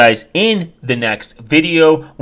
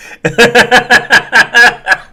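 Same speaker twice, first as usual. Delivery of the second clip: a very high, loud burst of laughter; high shrieking laugh; 11 semitones higher, 5 dB louder than usual. Real, not acted. Vlogger cracks up at his own bad-teeth joke, high loud laugh. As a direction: cackle at your own joke.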